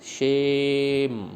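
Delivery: शेम very slowly, the "e" held long; one long sustained शेम; vowel demo. Shown as a demonstration. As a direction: stretch शेम right out so the vowel is heard. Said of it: "कौन सा आपको लग रहा है स्वर".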